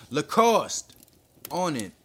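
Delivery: loud, high, sharp order; urgent shout. A narrator's reading. Inspector calling his officer into action, urgent shout. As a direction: shout it across the crowd. Order across crowd amid explosions.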